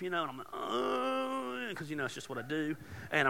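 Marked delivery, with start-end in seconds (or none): 0.5-1.7 a high, groaning moan, half-asleep; imitated sleep moan. Retelling his heartburn night, a mock sleepy moan. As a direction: mimic your own moaning in bed with heartburn. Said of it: "I'm a moaner in my sleep".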